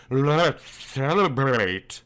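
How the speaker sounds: slow, low seductive invitation; seductive husk. A narrator's reading. Wife invites her stripped husband, slow and seductive. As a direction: tempt him with it, slowly. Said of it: "she husked seductively".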